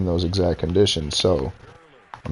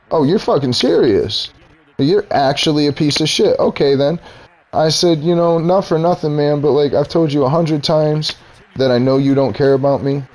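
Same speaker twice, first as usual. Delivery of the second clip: much higher, heated retold telling-off; retold angry rant; 7 semitones higher than usual. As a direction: lay into him, voice pitched up with outrage. Retold angry confrontation continues.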